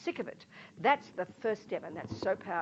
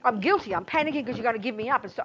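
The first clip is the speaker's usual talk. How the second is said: ordinary talk, nothing marked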